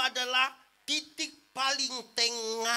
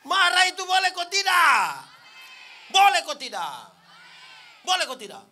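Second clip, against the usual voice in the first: loud, high demanding question; shouted question; 4 semitones higher, 11 dB louder than usual. Real, not acted. Preacher demanding an answer from the congregation, loud. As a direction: shout it to wake them up.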